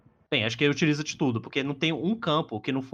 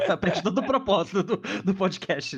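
laughing; 7 semitones higher than usual. Real, not acted. Laughs at joke about podcast.

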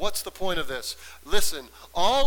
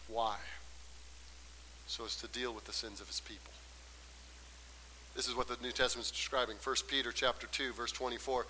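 much softer, lower voice after the climax; hushed preaching; 5 semitones lower, 17 dB quieter, faster than usual. Real, not acted. Preacher gives congregation the reason and verse, hushed. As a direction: drop right down, intimate and low.

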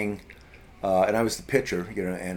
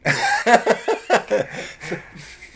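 high, bursting laugh; real laugh; 13 semitones higher, 6 dB louder than usual. Real, not acted. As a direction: laugh out loud at the joke. Laughing at feminine Furies joke.

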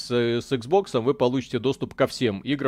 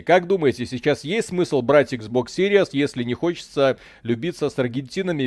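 normal talking voice

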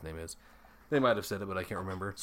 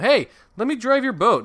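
high, loud mock-girl call; eager girl imitation; 13 semitones higher, 10 dB louder than usual. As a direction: call out like a pushy kid. Acting out girl in film.

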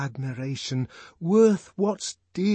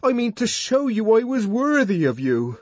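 high, loud, impassioned explanation; fervent, strained outburst; agitated pleading; 11 semitones higher, 6 dB louder than usual. A narrator's reading. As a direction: plead to be understood, voice pushed up. Savage anxiously explains himself to her.

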